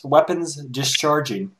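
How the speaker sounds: plain reading voice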